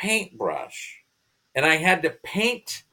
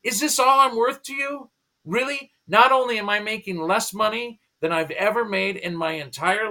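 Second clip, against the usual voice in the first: a higher-pitched retold rant, indignant; angry rant at God; 8 semitones higher than usual. A frustrated man painting dead faces at night, ranting to God in retold, higher-pitched anger. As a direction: replay the complaint, pitched up and aggrieved. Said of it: "And I just, I get angry".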